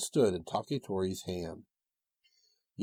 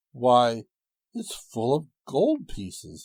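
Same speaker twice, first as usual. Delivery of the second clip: surprised; 3 semitones higher, 6 dB louder, slower than usual. Acted. Woodcutter finds gold.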